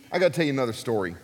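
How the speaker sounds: ordinary delivery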